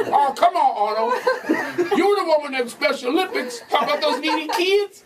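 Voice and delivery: high, hammed-up talk-show flattery; mock Hollywood gushing; gushing celebrity kiss-up. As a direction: push the voice up high, fawning over a fellow star. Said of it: "This is word for word their conversation".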